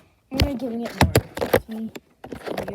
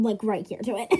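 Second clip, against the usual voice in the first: normal talking voice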